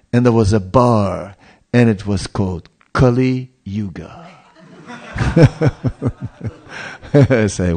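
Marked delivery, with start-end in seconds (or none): 5.1-6.5 amused chuckle. Real, not acted. Preacher amused by bar's name, chuckling. Bar named Kali Yuga.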